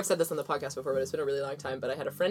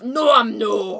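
louder Scottish catchphrase; Scottish accent; 3 semitones higher, 7 dB louder, much slower than usual. Shown as a demonstration. Showing the phrase to get into character, Scottish accent. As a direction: lean hard into a Scottish accent. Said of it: "do the Scottish accent".

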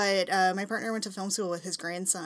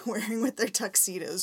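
usual speaking voice

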